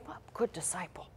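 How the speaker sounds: breathy whispered approval; mock admiring whisper. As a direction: whisper it like fawning onlookers. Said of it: "People out there in the world will look at this and say".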